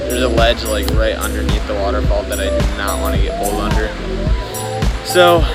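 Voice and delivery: usual speaking voice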